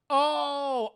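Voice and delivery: high, loud drawn-out oh; mock eureka moment; sudden realization. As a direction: exclaim as the clue clicks.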